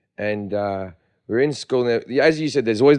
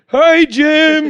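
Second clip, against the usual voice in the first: high, loud, breezy hello; cheery greeting; 14 semitones higher, 8 dB louder than usual. Retold or imitated. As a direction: call out casually while upside down. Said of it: "like that".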